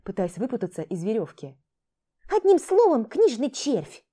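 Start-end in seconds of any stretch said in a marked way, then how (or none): 2.3-4.0 high, loud scornful jab; contemptuous. Acted. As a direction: sneer at him. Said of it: "презрительно бросила воровка".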